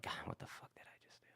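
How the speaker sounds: fast whispered self-reproach; whisper to himself. Retold or imitated. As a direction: hush it, stunned at what he just did. Alone in van, regretting outburst.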